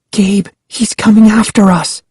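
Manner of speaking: put-on high, scared girl voice; urgent frightened whisper. A narrator's reading. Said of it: "Sari whispered".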